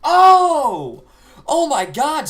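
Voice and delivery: loud startled cry; surprised shout. Real, not acted. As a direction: blurt it out in shock. Seeing soldier on video.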